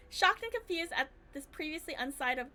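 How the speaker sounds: ordinary talk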